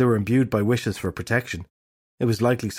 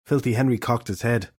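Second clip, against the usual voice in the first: usual speaking voice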